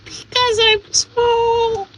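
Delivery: thin, tiny little-man voice; small-voiced guest. Tiny guest explains his medical problem to the host, small-voiced. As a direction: talk as if too small to be heard. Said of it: "makes me small voiced".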